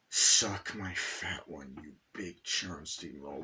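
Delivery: slow, low, deliberate defiance; defiant insult. Acted. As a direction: drag the insult out, dropping low and soft.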